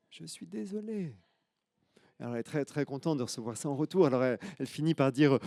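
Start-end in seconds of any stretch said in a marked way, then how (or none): 0.1-1.1 high, soft, slow faraway repeat; the apology coming back as an echo; faint distant echo. Acted. Echo answers the girl.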